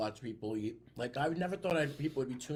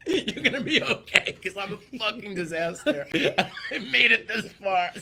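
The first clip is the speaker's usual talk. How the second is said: much higher and louder, laughing through it; laughing fan voice; 7 semitones higher, 10 dB louder than usual. Retold or imitated. Imagining a fan reacting, laughing. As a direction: say it while cracking up.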